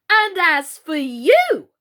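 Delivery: loud, slow, fierce threat. A narrator's reading. Alice turning on the Red Queen, fierce and loud. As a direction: round on her fiercely. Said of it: "turning fiercely upon the Red Queen".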